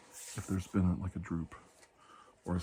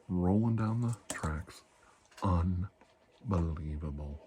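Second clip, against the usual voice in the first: drawn-out dramatic telling; dramatic slow; 2 semitones lower, much slower than usual. Acted. Retelling the train fire to the patient, slow and dramatic. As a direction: stretch each word for effect.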